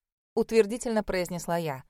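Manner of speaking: usual speaking voice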